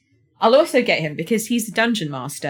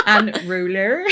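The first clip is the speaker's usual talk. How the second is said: laughing; 2 semitones higher, 5 dB louder, slower than usual. Real, not acted. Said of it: "Can't say it".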